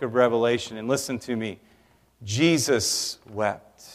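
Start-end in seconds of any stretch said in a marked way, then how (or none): 2.2-3.8 low, soft and very slow; hushed, solemn. Real, not acted. Pastor to grieving church, hushed and very slow. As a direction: let the two words hang quietly. Solemn key verse after "listen to me.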